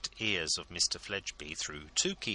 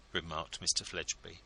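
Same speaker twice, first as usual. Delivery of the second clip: usual speaking voice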